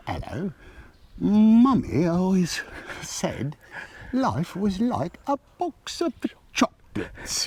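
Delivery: slow, posh-leaning British take on Forrest Gump, drawn out; British accent. Shown as a demonstration. Host asks for Forrest Gump line, slow British accent. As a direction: Forrest Gump line redone as a proper Brit. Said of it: "Can you do that in British accent?".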